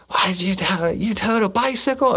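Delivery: high, strained boss voice mocking the question; squeaky put-on boss voice demanding why. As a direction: play the incredulous boss. Boss Kearney voiced, angry questioning.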